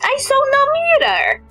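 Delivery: high-pitched protest, dropping at the end; exclaimed protest. Real, not acted. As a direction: blurt it high, then let it fall. Exclaims at game dialogue.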